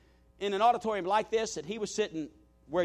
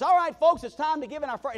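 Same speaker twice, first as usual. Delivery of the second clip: higher and louder, pumped announcement; excited pastor; 7 semitones higher, 6 dB louder than usual. Retold or imitated. New pastor calls the first offering to the congregation, excited. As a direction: announce it like a thrilled host. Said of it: "He was so excited".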